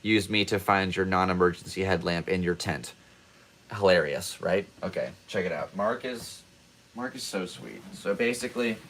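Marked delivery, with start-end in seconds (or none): none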